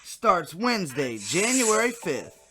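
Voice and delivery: loud TV promo voice; announcer. Acted. Announcing the fake channel's lineup, loud promo read. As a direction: boom it like a network promo.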